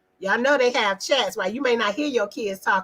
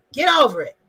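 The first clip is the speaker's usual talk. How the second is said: louder, curt brush-off; dismissive old-school parent; 3 semitones higher, 5 dB louder, faster than usual. Retold or imitated. Old-school parent to child, curt dismissive. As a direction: wave the complaint away. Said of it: "the old us".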